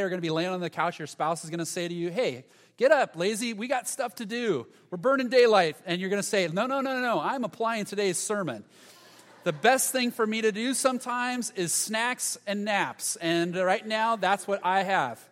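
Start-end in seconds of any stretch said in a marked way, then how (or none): 2.1-5.8 high, loud mock nag; hammed-up spouse rousing you off the couch; nagging spouse. 6.5-15.3 high, loud hammed-up protest; mock whiny refusal. Lazy reply to spouse.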